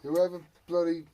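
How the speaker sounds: ordinary delivery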